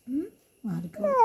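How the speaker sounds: crying. Real, not acted. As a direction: sob the words out quietly. Child crying while mother comforts. (കരഞ്ഞുകൊണ്ട് പതുക്കെ)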